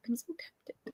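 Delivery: a soft, breathy whisper of "tempted"; hushed aside. Shown as a demonstration. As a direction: whisper it like a secret confession. Whispers it the second time.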